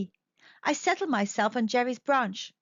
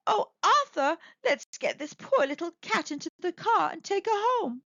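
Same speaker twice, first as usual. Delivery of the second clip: lightly voiced old lady; 6 semitones higher than usual.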